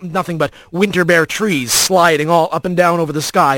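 ordinary delivery

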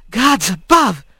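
out-of-breath exclamation; panting. A narrator's reading. Fisher watching the hawks battle, panting. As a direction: gasp it out between pants. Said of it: "came a panting voice".

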